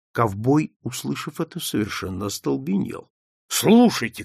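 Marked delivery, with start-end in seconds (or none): 3.5-4.2 higher, louder cry; shouting. A narrator's reading. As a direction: shout to get their attention. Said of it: "крикнул он". (крик, громко и выше)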